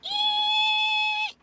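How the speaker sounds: very high, loud squeal; long piercing "eee"; brake squeal imitation. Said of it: "It's going".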